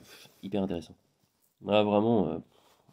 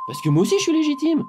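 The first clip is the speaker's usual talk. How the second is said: excited; 4 dB louder than usual. Retold or imitated. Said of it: "Il me dit".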